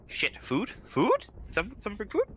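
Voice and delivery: hopeful disbelief, rising in pitch; surprised villagers. Acted. Villagers gasping at newcomers carrying food, surprised and rising.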